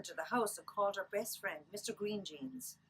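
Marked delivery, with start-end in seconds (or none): none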